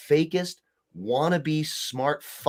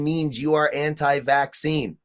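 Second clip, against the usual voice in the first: normal talking voice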